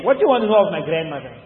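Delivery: quick, irritated retort; fed-up, rapid-fire question. Retold or imitated. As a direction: imitate someone pestered past their patience. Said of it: "want you to get irritated and say".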